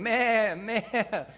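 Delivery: high, loud mock bleat; silly high sheep noise. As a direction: bleat it out like a lamb. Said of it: "the lion's going".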